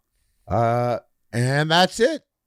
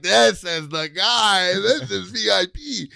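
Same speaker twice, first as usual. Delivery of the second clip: much higher and slow, a hammed-up bouncer; grand bouncer voice; 9 semitones higher, 4 dB louder, slower than usual. Tripping bouncer anointing a guest for VIP, high and slow. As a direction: crown the chosen guest, high and drawn out.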